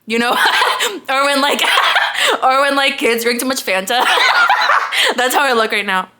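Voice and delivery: talking through loud giggles; laughing. Real, not acted. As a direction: keep laughing between words.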